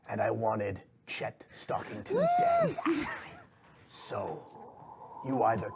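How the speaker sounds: hushed, deliberate villain with long pauses; restrained menace, weighing every phrase; impatient shadowy boss. Acted. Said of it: "the figure sighs very heavily and goes".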